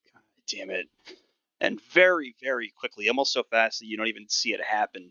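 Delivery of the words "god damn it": whispered grumble under the breath; grumbled whisper. Acted. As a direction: hiss the curse to yourself, annoyed. Ranger grumbles before shooting.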